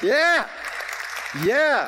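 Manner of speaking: high, loud triumphant yeah; loud celebratory yeah; joyful shout. Real, not acted. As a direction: cheer it out. Celebrating the accreditation news.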